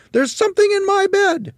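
very high, loud panicked shriek; screaming. A narrator's reading. Mrs. Twit tells her husband of a creature, screaming. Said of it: "screamed Missus Twit".

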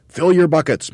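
high, loud command; Mugglewump ordering. A narrator's reading. Monkey leader to his crew, loud ordering. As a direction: call out an order, pitched well up. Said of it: "Mugglewump ordered".

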